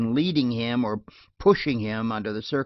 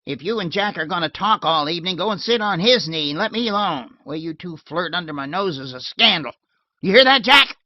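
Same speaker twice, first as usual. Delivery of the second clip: rising, louder irritated outburst; irritable; 6 semitones higher, 7 dB louder than usual. Acted. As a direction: snap at them, getting louder. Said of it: "Fred's voice rose irritably".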